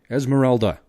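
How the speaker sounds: loud, high, urgent shriek; frantic shouted name; shrieking her name. Acted. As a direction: yell it right into her ear. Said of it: "he shrieked in her ear".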